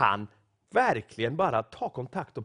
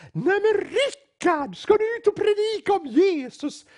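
hollering across the carriage; 6 dB louder than usual. Retold or imitated. Old church sister greeting the pastor across the train carriage, loud high holler. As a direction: call out loudly and gleefully, much higher than normal. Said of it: "tjoar i över hela kupén".

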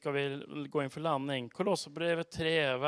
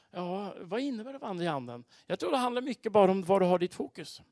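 ordinary talk, nothing marked

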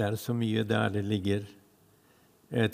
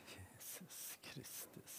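hushed whisper, slow; 2 semitones higher, 29 dB quieter, slower than usual. Real, not acted. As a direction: breathe the name softly. Speaker whispers a name.

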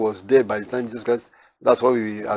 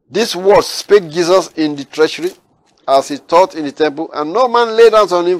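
higher, louder proclaiming of the verses; preaching at full force; 4 semitones higher, 5 dB louder than usual. Acted. Preacher proclaiming Jesus' answer to the Pharisees, forceful. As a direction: lift the words of Jesus over the church. Declaiming scripture.

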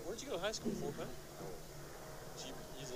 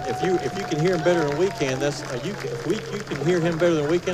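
loud; 20 dB louder than usual. Real, not acted. Radio host over loud stadium.

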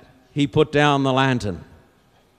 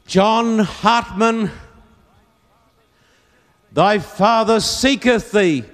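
slow, high call across a field; 8 semitones higher, 4 dB louder, slower than usual. Acted. Old Quaker searching the field for his son, calling out. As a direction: call out with long drawn vowels. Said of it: "cup his hands and he'd call out".

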